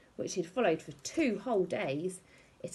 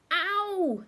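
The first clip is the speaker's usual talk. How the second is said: high sharp yelp of pain; a startled cry of pain; sudden ow; 12 semitones higher, 5 dB louder than usual. A narrator's reading. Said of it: "said Eric, opening his eyes very wide".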